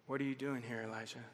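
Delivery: low and hushed, almost a murmur; a tender, quiet calling of a name; soft voice of God. Acted. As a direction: ask it gently from the quiet. The voice of God in story.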